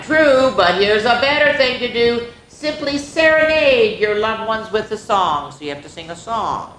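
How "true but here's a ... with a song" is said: a touch higher, mild mock shout; lightly voiced excited zebra. Zebra pitches her idea to Lionheart, mildly shouted. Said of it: "Zora Zebra shouted".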